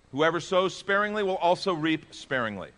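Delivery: high, loud, slow proclamation; proclaimed scripture. Acted. Pastor proclaiming the verse to the church, loud and slow. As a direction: ring out each word of the verse.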